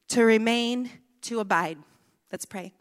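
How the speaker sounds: usual speaking voice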